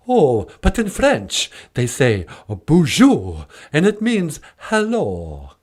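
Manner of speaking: put-on slow, swooping vampire accent; Count Von Count. Count puzzling over French with the host, theatrical vampire voice. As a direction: draw each phrase out like a puppet Count. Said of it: "Count Von Count".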